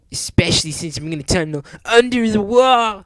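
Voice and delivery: high, loud cartoon yell; brash hero hollering. A narrator's reading. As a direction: build to a shout everyone can hear. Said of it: "he yelled".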